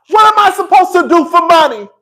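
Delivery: high furious shout; Bernie furious. Retold or imitated. Wife shouting at cheating husband, loud and high. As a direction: yell at him, outraged. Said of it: "Bernie was pissed".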